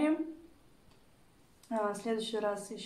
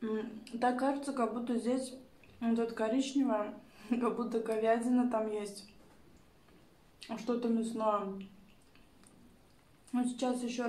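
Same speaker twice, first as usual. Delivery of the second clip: chewing while talking; eating and speaking; mouth full. Real, not acted. Seen 0: Bite taken at -4; lips pressed, chewing motions while speaking throughout.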